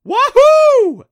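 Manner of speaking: high, very loud whoop; triumphant yell. A narrator's reading. Adam cheering as the beast crashes into rock, yelling. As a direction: let out a big drawn-up cheer. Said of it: "Adam yelled".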